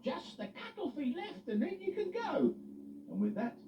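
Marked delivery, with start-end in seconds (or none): 0.0-2.5 very high chirpy voice; Freddie the forklift. Acted. Freddie telling Eddie what is left, chirpy. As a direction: rattle it off eagerly.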